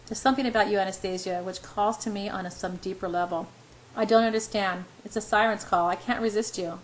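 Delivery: ordinary delivery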